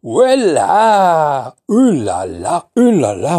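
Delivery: loud, sing-song ooh la la; delighted uella. Shown as a demonstration. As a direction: tease a friend with joy. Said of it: "Like this".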